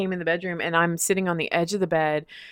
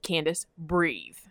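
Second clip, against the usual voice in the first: slow calm instruction; 7 dB quieter, slower than usual. Retold or imitated. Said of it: "he goes".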